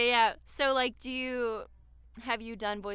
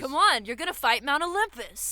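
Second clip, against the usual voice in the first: hype coach voice; 5 semitones higher, 8 dB louder than usual. Retold or imitated. Joining the hype bit.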